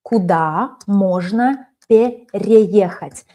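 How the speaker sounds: slow, careful teacher read; slow model read. Shown as a demonstration. Teacher repeats the question slowly for students. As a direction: draw the words out slowly. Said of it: "notice how I used here perfective verb".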